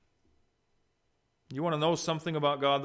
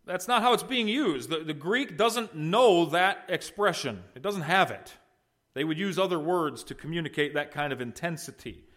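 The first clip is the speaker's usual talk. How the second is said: ordinary talk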